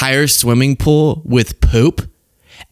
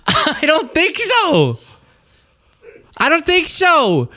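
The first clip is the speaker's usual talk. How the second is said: mocking dismissal; 14 semitones higher, slower than usual.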